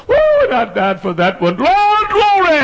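high, loud joyful shout; Jesus rejoicing. Retold or imitated. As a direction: shout glory like Jesus in heaven. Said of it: "and Jesus says".

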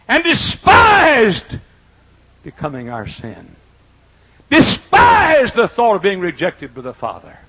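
high, loud, slowly stretched preaching cry; preaching at full force. Acted. Preacher reaching the climax of his sermon to the congregation, loud and stretched. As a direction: hold despised long and ring it out.

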